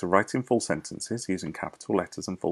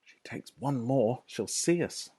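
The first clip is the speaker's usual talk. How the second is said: whispering, slightly higher; whispered thought; 5 semitones higher than usual. Acted. Fox hiding in the cellar, whispering tensely. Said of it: "thought Mister Fox".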